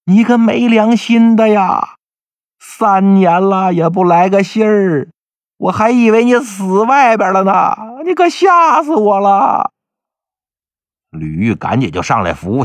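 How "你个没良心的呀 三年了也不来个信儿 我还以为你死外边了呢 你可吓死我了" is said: slow, high-pitched, drawn-out wail; hammed-up sobbing wife berating her long-lost husband; loud wailing. A narrator's reading. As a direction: wail reproachfully, dragging every phrase higher. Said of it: "紧接着就嚎啕大哭啊".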